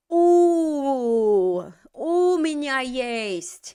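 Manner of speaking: loud, slow «У меня есть», stress on у; corrected phrase, slow. Shown as a demonstration. Teacher slowly models У меня есть for the student. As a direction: drag out the У. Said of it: "He needs to say".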